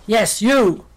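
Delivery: loud, roaring lion voice; booming, forceful exclamation; lion's roar. A narrator's reading. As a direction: roar it at the mouse. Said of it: "roared the lion".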